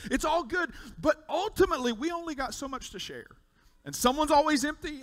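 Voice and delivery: loud, high, ringing preacher's build; preaching at full force. Acted. Pastor rallying the congregation, preaching at full force. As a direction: preach it out to the whole room.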